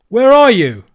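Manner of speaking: high, very loud hail; shouting. A narrator's reading. Andrew searching for the voices, shouting. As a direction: bellow the question into the distance. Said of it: "shouted Andrew".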